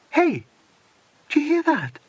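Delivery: sudden high call; alert surprise. Acted. Dinah to the others hearing a helicopter, high alert call. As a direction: stop everyone to listen.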